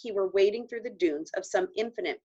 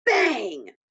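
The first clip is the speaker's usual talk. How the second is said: bang; 4 semitones higher, 6 dB louder than usual. Acted. Gunshot in the story.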